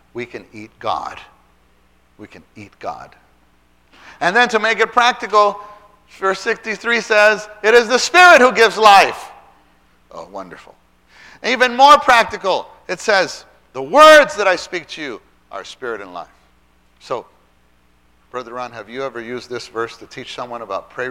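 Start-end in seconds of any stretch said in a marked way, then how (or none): 4.2-9.3 loud, high, rousing proclamation; emphatic proclamation. Real, not acted. Preacher driving a verse home to teachers, loud emphatic proclamation. 11.4-16.3 loud, high, rousing proclamation; emphatic proclamation. Real, not acted. Preacher driving a verse home to teachers, loud emphatic proclamation.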